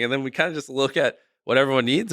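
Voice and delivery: usual speaking voice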